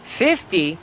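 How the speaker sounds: ordinary delivery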